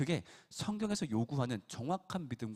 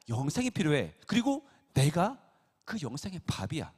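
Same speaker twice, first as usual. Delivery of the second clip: loud, urgent sermon voice as Jesus; Jesus pleading loudly with his followers; preaching at full force; 4 semitones higher, 7 dB louder than usual. Acted. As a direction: hammer it home to the crowd.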